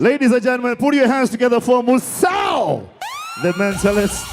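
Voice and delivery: loud hyped emcee intro; emcee announcement. Acted. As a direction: announce the act to the crowd. Host introducing the act.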